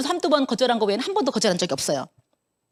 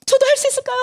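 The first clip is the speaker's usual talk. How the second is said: much higher, imitating a timid friend asking hopefully; high-pitched retold plea, louder; hopeful timid question; 14 semitones higher, 4 dB louder than usual. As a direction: mimic her small hopeful plea.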